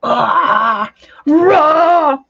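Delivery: wordless roar of frustration; frustrated roar. Real, not acted. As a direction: groan building into a loud roar. Frustrated by failing robotic audio.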